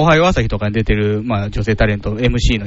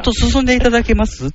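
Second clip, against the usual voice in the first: mock elegant lady; 9 semitones higher, faster than usual. Acting a Kano sister aloud.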